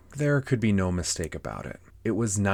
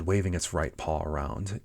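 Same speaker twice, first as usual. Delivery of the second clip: usual speaking voice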